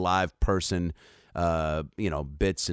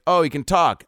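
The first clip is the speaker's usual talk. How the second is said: high, louder mock amazement; amazed exclamation; 9 semitones higher, 6 dB louder than usual. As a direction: exclaim as the astonished onlooker. Acting out amazed swimmer's reply.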